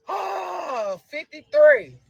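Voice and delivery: tearful shout of relief; crying out. Real, not acted. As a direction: let the relief break your voice. Overwhelmed mother cries out.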